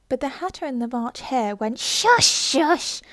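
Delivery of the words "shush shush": high, loud shushing; hushing shout. Acted. Hatter and March Hare silencing Alice, loud and high. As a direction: hush her sharply and loudly.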